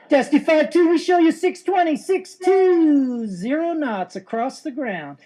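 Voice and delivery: high, loud call, final numbers stretched slowly; gleeful sing-song controller. Acted. Controller answering Navy pilot's speed check, gleeful sing-song. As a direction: savor every digit with triumphant glee. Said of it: "a little glee in the controller's voice".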